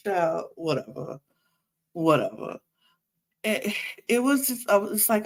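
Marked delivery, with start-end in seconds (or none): none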